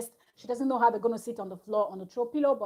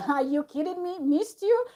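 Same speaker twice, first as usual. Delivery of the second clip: incredulous, pitch leaping up on the question; mock disbelief; 6 semitones higher than usual. Reacting to Caroline saying she missed [name], incredulous. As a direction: question it as if you can't believe it.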